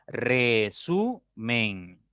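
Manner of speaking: "Resumen" drawn out very slowly as Re - su - men; teacher slowly sounding out each syllable of "Resumen". Shown as a demonstration. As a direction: split "Resumen." into three long, separate syllables. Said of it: "Tiene tres sílabas".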